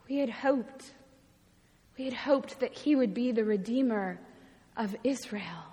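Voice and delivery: soft, halting, with long pauses; subdued confession. Acted. Travelers confiding lost hope to the stranger, subdued.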